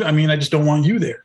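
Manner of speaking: normal talking voice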